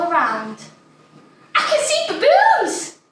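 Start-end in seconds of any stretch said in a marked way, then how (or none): 1.5-3.0 much higher, loud, thrilled; excited squeal. Acted. Child spotting baboons, squealing with excitement. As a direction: squeal it with delight.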